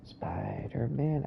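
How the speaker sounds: hushed, slow whisper. Pretend. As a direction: whisper it like you're sneaking. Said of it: "I have to whisper".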